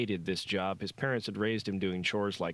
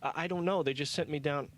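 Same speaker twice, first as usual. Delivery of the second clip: stammering; 7 semitones higher than usual. A narrator's reading. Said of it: "trembling intern".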